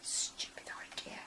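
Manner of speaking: a scornful whisper; whispered aside. A narrator's reading. Narrator whispering a dry aside. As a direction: breathe it out with disdain.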